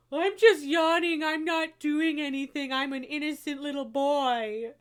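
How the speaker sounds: mock-innocent pet voice; voicing the cat. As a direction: play the cat protesting its innocence.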